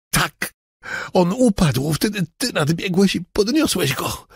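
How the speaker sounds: breathless, high-pitched confession; frightened man recounting. Acted. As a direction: tell it in a rising panic. Robert continues his account.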